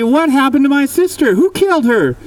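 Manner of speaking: high, urgent demand; demanding boys. Acted. Boys confronting Mitch about the sister, urgent. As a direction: press him hard for an answer.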